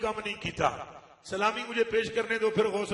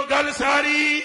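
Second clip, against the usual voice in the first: pressing, louder call to the crowd; say it forcefully; 5 semitones higher, 5 dB louder, slower than usual. Real, not acted. Preacher urging the crowd to say it forcefully, as a pressing call. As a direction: push the crowd to answer harder. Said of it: "دب کے بولو".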